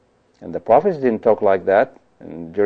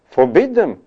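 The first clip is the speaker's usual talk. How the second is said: higher-pitched, mildly outraged order; indignant demand; 7 semitones higher than usual. Acted. Joshua urges Moses to stop the others, indignant. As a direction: press the demand with acted indignation.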